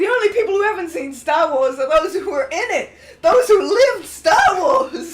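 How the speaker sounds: loud and high, laughing it out; laughing declaration. Real, not acted. To friends about Star Wars, laughing loud. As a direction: proclaim it through laughter.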